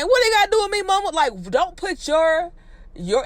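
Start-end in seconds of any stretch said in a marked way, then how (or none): 0.0-1.1 mock crying whine. Son pleading with his mother, fake crying whine. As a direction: whine it like a wounded kid.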